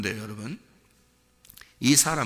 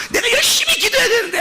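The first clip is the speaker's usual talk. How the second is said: high, strained mock fretting; acted anxious zeal; 6 dB louder than usual. Believer fretting over prayer duties, hammed-up anxious zeal. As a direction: wail the duty like an overzealous believer.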